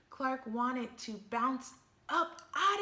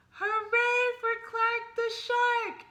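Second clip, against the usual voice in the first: much higher and louder cheer; 10 semitones higher, 9 dB louder than usual. Acted. Everyone cheering for Clark, loud cheer. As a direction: cheer it out as a crowd. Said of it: "everyone cheered".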